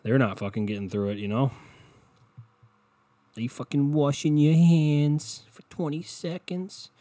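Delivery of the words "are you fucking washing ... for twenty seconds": high, slow sarcastic scold; mock lecture. Scolding listeners about handwashing, in a high slow mock nag. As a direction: lecture like a fussy nag, drawn out.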